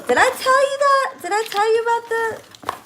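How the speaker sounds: high, loud squeal of surprise; amazed the friend knew; astonished. Real, not acted. Surprised the friend knew she needed a heater, high squeal.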